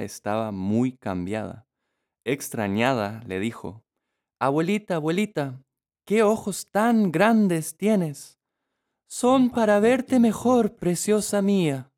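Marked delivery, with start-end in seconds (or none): 4.4-8.4 put-on amazed little girl. A narrator's reading. Girl asking the famous questions. 9.1-11.9 softened wolf voice. A narrator's reading. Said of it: "suavizando la voz".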